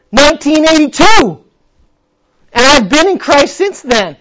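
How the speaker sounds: loud, high fervent preaching; preaching at full force. Acted. Preacher answering the demons with fervor. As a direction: proclaim it to the whole room.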